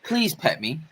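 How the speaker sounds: soft, pleading dog voice; voicing the dog begging to be petted. Soft begging dog. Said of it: "staring at me like".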